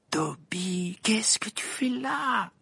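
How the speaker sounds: astonished hushed question. A narrator's reading. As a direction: whisper it, stunned. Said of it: "dit Harry stupéfait".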